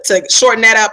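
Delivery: usual speaking voice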